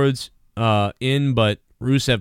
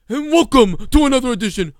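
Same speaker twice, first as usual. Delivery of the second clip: higher, louder announcer welcome with odd timbre; mock announcer intro; 9 semitones higher, 4 dB louder than usual. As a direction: overdo the ring announcer welcome. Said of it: "what the fuck is that".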